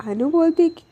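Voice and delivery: plain reading voice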